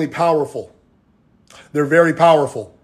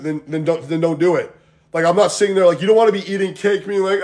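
ordinary talk

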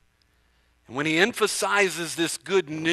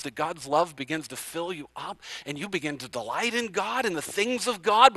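normal talking voice

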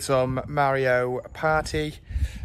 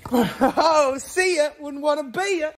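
gloating taunt; 10 semitones higher, slower than usual. Pretend. Dad taunting his son after beating him at football, gloating. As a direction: crow it at them, laughing.

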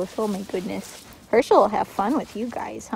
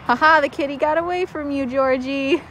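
laughing tease; 4 semitones higher, 6 dB louder than usual. Real, not acted. Teasing dog after chase.

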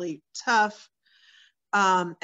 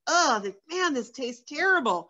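disgusted; 4 semitones higher than usual. Retold or imitated. Disgusted at her cigarette.

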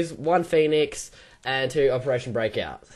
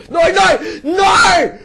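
high, loud pleading shout; 7 dB louder, much slower than usual. Real, not acted. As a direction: yell no at the screen. Near miss reaction.

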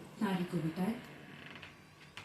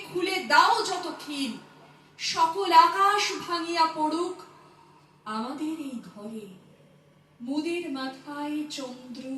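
impassioned recital, swelling loud and high, then sinking; poem recitation; 6 semitones higher, 5 dB louder than usual. Acted. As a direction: declaim the verse with dramatic pauses and sudden surges. Recites a poem after introduction. (আবেগময় কবিতা আবৃত্তি, ওঠানামা করা জোরালো কণ্ঠে)

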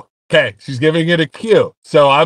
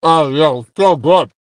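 chewing while talking; talking through a mouthful; mouth full. Real, not acted. Seen 0: man in cap chews with food visible in open mouth.

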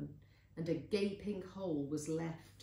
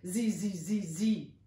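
loud chanted cicada zees; cicada buzz; 7 dB louder than usual. A narrator's reading. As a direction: imitate a buzzing cicada. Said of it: "of a cicada".

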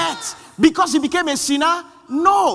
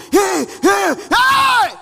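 higher, louder yell of "hey, hey, hey!"; mock shout at the screen; 7 semitones higher, 4 dB louder, much slower than usual. Preacher mocking moviegoers, shouting at the screen.

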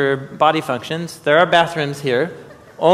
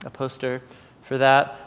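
normal talking voice

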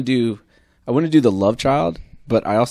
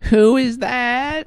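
high slow squeaky question; Fran Drescher voice; 10 semitones higher, much slower than usual. Acted. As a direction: drag it out like the Nanny. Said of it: "How does Fran Drescher talk?".

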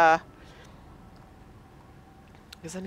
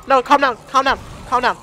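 higher and louder, panicky repeats; frantic pleading; 7 semitones higher, 8 dB louder than usual. Real, not acted. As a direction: beg the thing to calm down, loud and frantic. Monster grabbing him in fight.

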